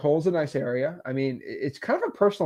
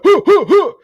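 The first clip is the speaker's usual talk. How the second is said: mock aggressive huffs; 12 semitones higher, 10 dB louder than usual. Mocking aggressive seller's grunts.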